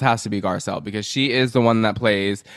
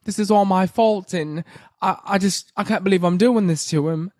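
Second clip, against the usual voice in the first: higher, put-on London accent; 7 semitones higher than usual. Imitating [name]'s London accent from My Little Love. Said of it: "that was ridiculous".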